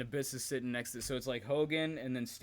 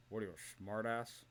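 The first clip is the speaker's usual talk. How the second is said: a light, quieter gruff retort; grumpy agent; 6 dB quieter than usual. Retold or imitated. Imitating agent Tony Garea brushing off a question, grumpy. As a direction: mutter it back like a cranky old agent. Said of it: "He does a Tony Garea".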